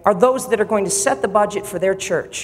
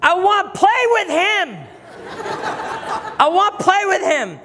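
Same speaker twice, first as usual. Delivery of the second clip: put-on high, slow little-boy voice, laughing through it; little son's voice; 13 semitones higher, slower than usual. Father imitates his young son wanting to play with God, high laughing child voice. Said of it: "He goes".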